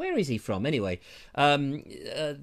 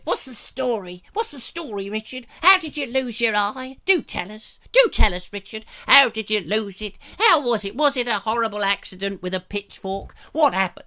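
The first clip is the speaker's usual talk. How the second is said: nagging viewer; 6 semitones higher, 4 dB louder than usual. Retold or imitated. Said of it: "he goes on and on".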